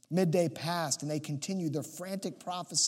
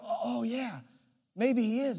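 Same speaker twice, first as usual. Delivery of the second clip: higher-pitched mock concession; sudden realization; 5 semitones higher than usual. Prophets agreeing their god may be asleep, mock realization. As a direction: act it out as a dawning thought.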